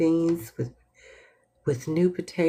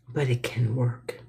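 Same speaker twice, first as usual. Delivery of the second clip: whisper; 5 semitones lower than usual. Shown as a demonstration. Whispered affirmation.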